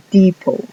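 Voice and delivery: depot said "dipo", T silent; no T at the end of depot; English word depot. Shown as a demonstration. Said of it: "la T de al final no suena". ("depot" dicho "dipo")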